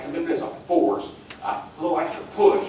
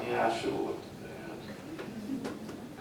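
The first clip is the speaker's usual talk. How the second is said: rueful aside; 3 semitones lower, 13 dB quieter, much slower than usual. Retold or imitated. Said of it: "I looked at him like".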